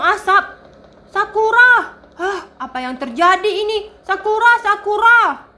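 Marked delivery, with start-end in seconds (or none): 1.1-5.5 calling out. Acted. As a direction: shout her name up to the roof. Child calls out to sister.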